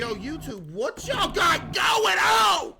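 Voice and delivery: big booming streamer hello; loud greeting. Acted. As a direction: shout it out slowly to chat. Streamer greets audience, mock shout.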